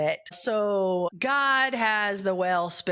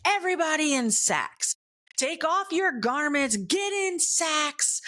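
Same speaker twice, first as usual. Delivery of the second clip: king's command; 8 semitones higher than usual. Retold or imitated. King commanding his town to put on sacks. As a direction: proclaim it like a king to his people.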